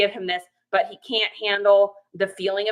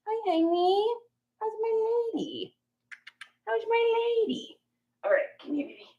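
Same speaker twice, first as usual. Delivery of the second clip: cooing pet names; 11 semitones higher, 6 dB quieter than usual. Real, not acted. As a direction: coo sweetly at the pet. Talking to her dog.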